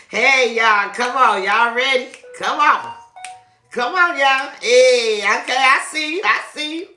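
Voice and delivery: high, loud hype; excited. Real, not acted. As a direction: pump up the viewers, high and loud. Hyped sign-off to viewers.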